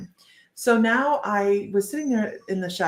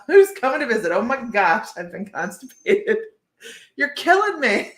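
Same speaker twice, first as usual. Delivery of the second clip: mock shocked customer; 4 semitones higher than usual. A customer at the shop reacting to the card in mock horror. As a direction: ham up a horrified customer.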